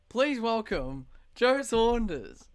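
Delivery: loud, slow showman announcement; announcer intro. Acted. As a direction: bellow it like a game show host. Host announcing guest.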